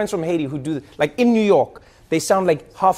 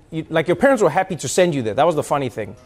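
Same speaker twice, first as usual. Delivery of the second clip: usual speaking voice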